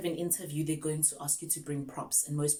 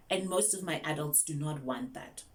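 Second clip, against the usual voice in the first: ordinary talk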